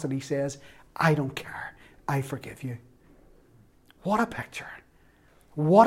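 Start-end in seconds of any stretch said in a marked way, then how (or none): 1.0-2.8 tender whisper; whispered forgiveness. Acted. Heavenly Father forgiving the sinner, whispered. 4.0-4.7 soft wondering whisper; awed whisper. Real, not acted. Preacher to congregation about the forgiving Father, whispered.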